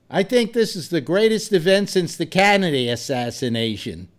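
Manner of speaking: a hint of Boston accent. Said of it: "this is in his Boston accent".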